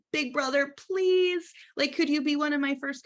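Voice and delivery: high, pleading big brother, please; pleading little sister. Pretend. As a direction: beg your big brother sweetly.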